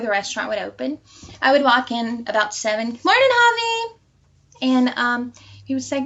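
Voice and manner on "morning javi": high sing-song morning hello; lilting, much higher good-morning call; chirpy greeting. Retold or imitated. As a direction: greet him brightly, trying to get noticed. Cheerful greeting to crush each morning.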